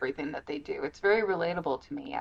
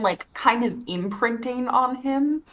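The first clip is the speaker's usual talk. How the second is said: usual speaking voice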